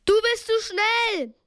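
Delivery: high-pitched urgent call; high, projected shout; shouted warning. A narrator's reading. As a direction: call out to someone racing ahead. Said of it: "rief Justus".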